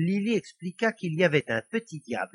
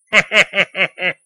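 loud, higher staccato cackle; devilish snicker; 4 semitones higher, 11 dB louder than usual. A narrator's reading. Devil amused by his fire plan, staccato snicker. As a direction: cackle at the wicked idea.

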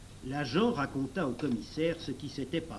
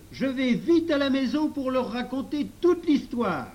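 high, loud yell to a friend; shouted call; 11 semitones higher, 9 dB louder than usual. A narrator's reading. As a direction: call out at the top of your voice. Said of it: "en lui criant".

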